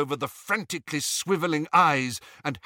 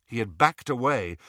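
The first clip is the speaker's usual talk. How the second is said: plain reading voice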